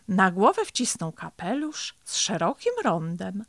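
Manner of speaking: usual speaking voice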